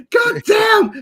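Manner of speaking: high, loud mock-agonized yell; imitated cry of pain. Co-host imitating the man in the bathroom, pained shout. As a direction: yell it like the chicken is burning you. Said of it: "Cries of pain".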